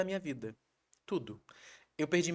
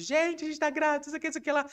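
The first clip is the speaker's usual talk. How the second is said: much higher, louder excited wife voice; mimicking excited wife; 14 semitones higher, 5 dB louder, faster than usual. Husband imitating his wife announcing the pregnancy on a video call. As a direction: gush it high to friends. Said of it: "fazendo chamada de vídeo".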